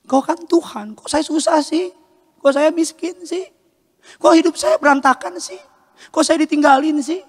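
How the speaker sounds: high, plaintive whining questions; a poor kid asking God why; complaining to God.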